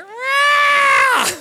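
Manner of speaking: high, loud, drawn-out roar; cub's attempted roar. Preacher acts Simba's next try at roaring, high cub roar. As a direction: belt out a squealed little lion roar. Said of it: "he takes a big breath".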